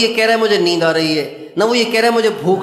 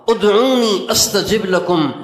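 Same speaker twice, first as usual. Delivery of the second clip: slow, drawn-out Arabic recitation; Quran verse recitation; 3 semitones higher, slower than usual. Acted. Preacher quoting Allah's promise, slow recitation. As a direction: recite it measured, stretching the vowels.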